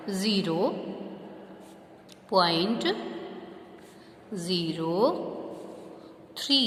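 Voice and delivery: Zero, point, zero, three each set apart by long pauses; dictating digit by digit. Shown as a demonstration. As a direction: spell it out slowly for writing. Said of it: "जैसे इसको read करोगे ना वैसे ही right करना है". (एक-एक शब्द रुककर लिखवाते हुए)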